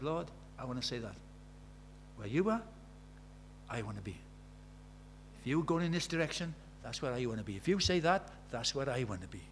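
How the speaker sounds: soft, hushed devotion; quiet prayer. Retold or imitated. Preacher repeating his private prayer to the Lord, softly. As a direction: pray it gently, barely aloud. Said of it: "in my quiet time, I just said".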